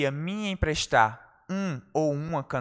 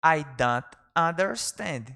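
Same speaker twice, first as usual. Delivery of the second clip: slow model phrase; slower than usual. Shown as a demonstration. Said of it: "teacher models phrase slowly".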